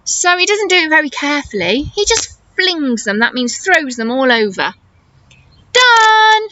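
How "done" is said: loud, higher triumphant shout. Acted. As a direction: yell it out like a proud boy. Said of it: "he shouts".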